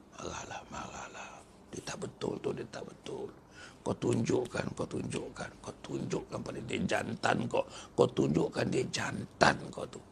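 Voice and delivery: hushed, unvoiced whisper of the devil goading; the devil whispering, egging a man to fight; devil's whisper. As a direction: breathe it in his ear to stir up anger.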